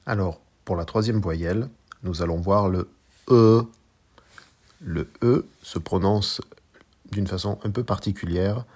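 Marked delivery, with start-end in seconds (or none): 3.3-3.6 E said more through the nose than French E; nasal Mandarin E. Shown as a demonstration. Teacher introducing the third vowel, nasal E. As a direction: hold E loud, level and a bit nasal. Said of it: "un peu plus avec le nez".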